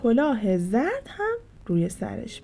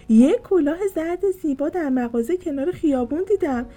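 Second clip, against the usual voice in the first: excited; 7 semitones higher, 6 dB louder than usual. Acted. Maya eagerly tells the secret.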